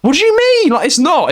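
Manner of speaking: high-pitched baffled protest; hammed-up incredulous retort, voice jumping up; mock exasperation. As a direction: squeal it in comic disbelief. Exasperated reply to scam call, acted.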